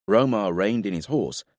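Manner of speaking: plain reading voice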